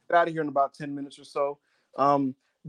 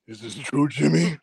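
low, menacing Roman impression; Darth Vader voice; 3 semitones lower than usual. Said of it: "talking like Darth Vader".

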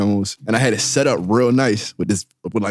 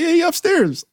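much higher, light and cheerful; high mock grandparents; slower than usual. Grandparents let her in, high cheerful voice. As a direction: squeak it like an innocent relative. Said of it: "so they like".

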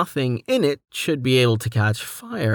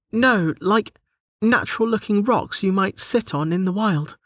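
high-pitched heroine voice, explaining; clarify it in a high character voice; Delta clarifying; 9 semitones higher than usual. A narrator's reading. Delta clarifies to the menu, high explaining voice. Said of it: "she clarified".

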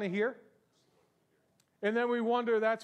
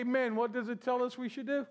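ordinary delivery

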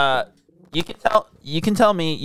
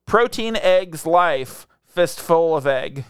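slow reading; much slower than usual. Shown as a demonstration. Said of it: "So this says".

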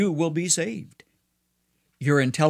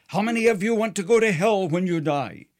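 raised, higher-pitched pastor's question; mildly loud question; 6 semitones higher than usual. A narrator's reading. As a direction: put the question with a touch more force, pitched up. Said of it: "the pastor loudly asked".

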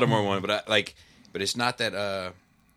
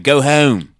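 HOME with a heavy Baltimore long O; heavy Baltimore accent; 3 semitones higher, 6 dB louder, slower than usual. Shown as a demonstration. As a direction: put on a thick Baltimore accent, a bit louder. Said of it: "you would get the heavy".

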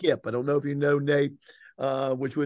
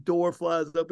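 ordinary talk, nothing marked

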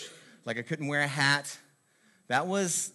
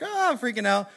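mock freak-out; 8 semitones higher, 4 dB louder than usual. Acting out panicking mom.

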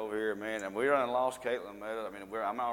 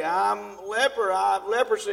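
hollered warning; 6 semitones higher, 9 dB louder than usual. Acted. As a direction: holler it out to people at a distance. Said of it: "holler at 'em and say".

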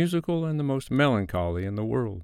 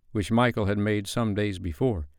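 plain reading voice

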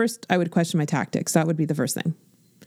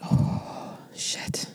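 breathy unvoiced whisper, much softer; whispered curse; 7 dB quieter than usual. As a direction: hush it out like a secret panic. Voicing her own private panic.

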